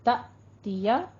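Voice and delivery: "त दिया" with the voice caught after "त"; brief glottal stop. Shown as a demonstration. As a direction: stop the voice sharply on "त", then carry on into "दिया". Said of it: "थोड़ा सा रोक के चलेंगे".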